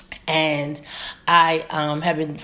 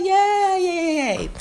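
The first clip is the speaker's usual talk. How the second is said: excited cheer; 13 semitones higher, much slower than usual. Real, not acted. Host cheers at starting Talk About It Tuesday, squealing yay. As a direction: cheer like a delighted fan. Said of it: "I'm super stoked".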